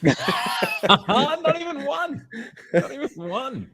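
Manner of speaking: laughing it out in disbelief; high, slow words through real laughter. Real, not acted. Co-host fails to name a swimmer, laughing.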